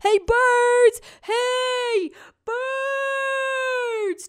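high calling shout up to the sky; yelling turtle. Acted. Turtle shouting up to the flying birds, high and straining. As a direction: call out to birds far above. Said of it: "Turtle yelled and yelled".

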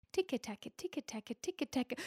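tapping sound. A narrator's reading. Tapping sound of leprechaun's hammer.

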